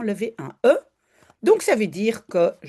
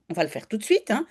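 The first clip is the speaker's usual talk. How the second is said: normal talking voice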